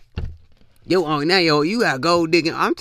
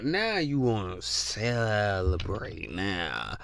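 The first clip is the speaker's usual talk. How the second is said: drawn-out sarcasm; 6 semitones lower, 7 dB quieter, much slower than usual. Real, not acted. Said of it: "slow drawn repeat".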